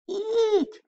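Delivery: very high startled squeak; a tiny frightened squeak. Acted. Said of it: "Billy squeaked".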